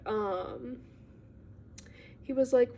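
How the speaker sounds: normal talking voice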